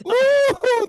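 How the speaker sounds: sudden high squeal. Pretend. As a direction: squeal with mock horror. Squeal at the girls.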